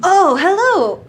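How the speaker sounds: high slow hello from the scholar; [name]'s voice. Acted. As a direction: stretch the hello like a delighted old scholar. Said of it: "What was his voice?".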